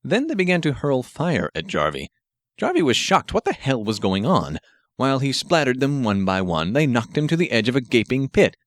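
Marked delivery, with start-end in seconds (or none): none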